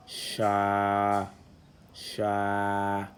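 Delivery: "sha" held long on one level note; lengthened A vowel. Shown as a demonstration. Teacher reading a syllable with alif, long held "sha". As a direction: stretch the a of "sha" well out. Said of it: "l'outil d'allongement à la voyelle A".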